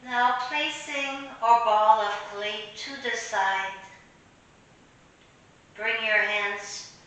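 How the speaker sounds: unhurried meditation voice with long pauses; guided meditation. Real, not acted. Teacher leading listeners through an imaginary clay meditation, guided meditation.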